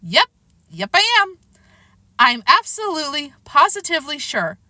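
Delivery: put-on high, emphatic kid insisting; insistent. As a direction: insist you are completely sure. Kid insists to doubting coach.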